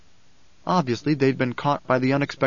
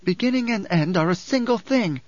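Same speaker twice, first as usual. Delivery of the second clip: high, stern retort; snapping; 6 semitones higher than usual. A narrator's reading. Alia retorts to a petitioner, snapping. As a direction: snap it back as a rebuke. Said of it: "Alia snapped".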